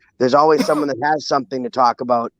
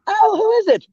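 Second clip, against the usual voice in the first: much higher, answering the phone; high put-on voice. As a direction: squeak it like a surprised politician.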